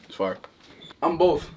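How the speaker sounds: normal talking voice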